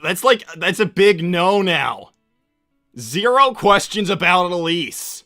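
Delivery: loud, firm ban; exasperated insistence. Acted. Sonic banning questions about Elise, loud and exasperated. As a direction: lay down the rule loudly, fed up.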